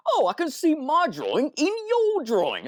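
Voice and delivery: high, laughing bad British accent. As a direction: mimic a terrible British accent. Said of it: "he has a terrible British accent".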